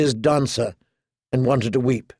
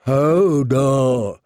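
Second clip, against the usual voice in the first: long drawn-out mournful moan of a name; slow, mournful character voice. A narrator's reading. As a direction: draw the word out sorrowfully. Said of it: "said Hodor mournfully".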